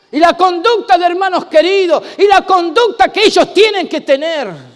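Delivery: loud, driving sermon voice; preaching at full force. Acted. Pastor preaching loud to the congregation about applause. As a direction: preach it out to the whole room.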